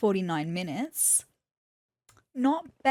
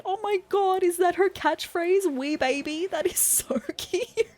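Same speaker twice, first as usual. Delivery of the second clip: gushing, higher and laughing; delighted squeal; 9 semitones higher than usual. Real, not acted. Player hears the villager's catchphrase, gushing delightedly. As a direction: coo over the cute catchphrase.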